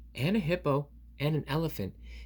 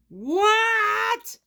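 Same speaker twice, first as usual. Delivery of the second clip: much higher, louder yelp; shocked; 9 dB louder than usual. Acted. Piggy hears about the piano, shocked shriek. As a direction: shriek in disbelief.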